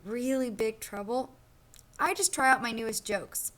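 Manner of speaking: lively storybook read-aloud for a class; reading to children. Acted. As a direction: read it like a picture book to kids. Teacher reads picture book aloud.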